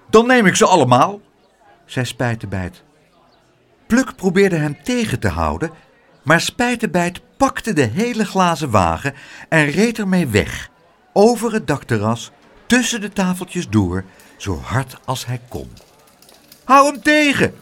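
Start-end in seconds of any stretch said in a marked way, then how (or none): 0.1-1.2 loud, fast, cheeky retort; defiant child. A narrator's reading. Child defying the aunt over cake, loud and fast. 16.6-17.5 high, loud call for help; alarmed shout. A narrator's reading. Aunt seeing the child race off, high shout. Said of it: "riep tante Pleeg".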